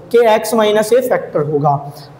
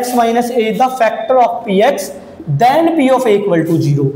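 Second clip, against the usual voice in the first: slow dictation; much slower than usual. Shown as a demonstration. Reading the theorem statement aloud.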